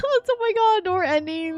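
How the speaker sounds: normal talking voice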